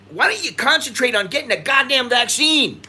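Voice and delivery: fed-up rant; exasperated. Real, not acted. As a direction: vent the frustration at them. Ranting about slow vaccine rollout.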